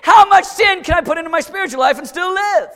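high, loud pleading question; mock shout. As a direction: fling the question out. Voicing the bargaining question.

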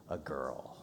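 whispered, dropping to almost nothing; a hushed whisper of the child's words. Retold or imitated. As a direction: breathe the last words out. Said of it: "she said".

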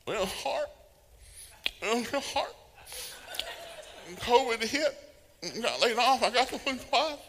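high whiny complainer; whiny complaining believer. Acted. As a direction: whine out every hardship like a sad sack. Acting out heaven conversation.

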